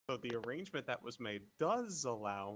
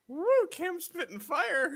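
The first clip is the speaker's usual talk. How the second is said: excited cheer; 12 dB louder than usual. Real, not acted. Cheering a bold line.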